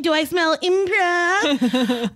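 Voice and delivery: slow, drawn-out mock sniffing-out; playful put-on. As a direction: draw it out with hammy suspicion. Playful put-on line about improv.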